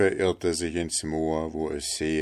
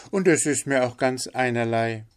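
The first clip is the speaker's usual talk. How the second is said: higher-voiced, unconcerned bird; indifferent linnet; 6 semitones higher, 4 dB louder, faster than usual. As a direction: dismiss it in a high voice. Figure of the linnet. (gleichgültiger Hänfling, hohe Stimme)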